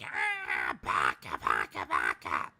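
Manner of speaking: rough, creaky mock outburst; imitating a streamer's croaky tantrum; Miko. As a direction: rasp out the insult in comic frustration. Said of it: "And she's like".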